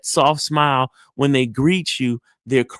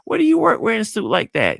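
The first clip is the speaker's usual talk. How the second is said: flattering interest; 6 semitones higher than usual. Shown as a demonstration. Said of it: "Ladies, you do the same thing".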